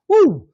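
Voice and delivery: high, loud "woo"; exclaimed woo. Real, not acted. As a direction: let out a high whoop, falling off. Exclaims after joking about trades.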